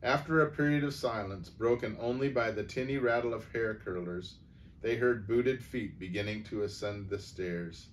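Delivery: ordinary delivery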